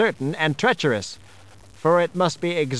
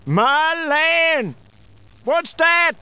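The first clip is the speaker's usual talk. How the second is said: much higher, startled cry; 11 semitones higher, much slower than usual. Acted. Mean man startled by a noise in the storm, much higher. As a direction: jump at a strange sound. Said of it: "look startled and take a listening attitude".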